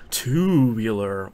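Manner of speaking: drawn-out eighties slang exclamation; totally eighties voice. Retold or imitated. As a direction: stretch it out slowly in a totally eighties voice. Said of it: "in, like, a totally eighties voice".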